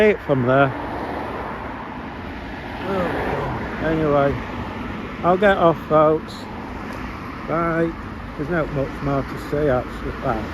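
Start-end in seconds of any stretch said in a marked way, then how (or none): none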